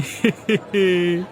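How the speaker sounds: gloating laugh. Acted. Owner gloats customers have no choice.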